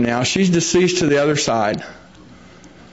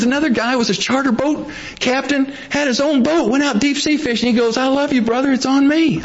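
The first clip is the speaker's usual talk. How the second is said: excited retelling; 10 semitones higher than usual. Retold or imitated. Retelling John's boasting, higher voice.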